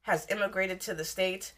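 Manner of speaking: ordinary talk, nothing marked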